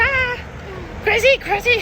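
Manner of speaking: high, loud mock-crazy yelp; shrieking like a lunatic to scare men off; faking crazy. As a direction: play the madwoman to scare them off. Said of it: "Moi, je fais".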